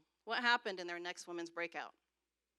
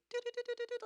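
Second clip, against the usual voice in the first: high sung do do do; a mock eerie tune, high; suspense hum; 3 dB louder, much faster than usual. Couple reacting to odd prophecy with eerie hum. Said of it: "my husband are like".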